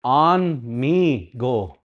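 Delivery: very slowly say "On me go"; careful teaching pace; slow literal gloss. Shown as a demonstration. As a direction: spell out "On me go" word by word. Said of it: "It's good".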